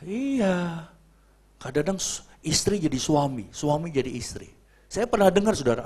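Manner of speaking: ordinary talk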